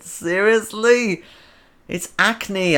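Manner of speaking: mock-offended disbelief; indignant teased streamer; incredulous. Real, not acted. Reacting to being teased about spots.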